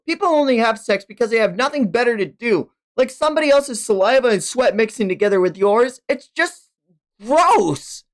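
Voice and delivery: lightly voiced scornful girl; annoyed Asa. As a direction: brush him off quickly. Asa's voice retorting.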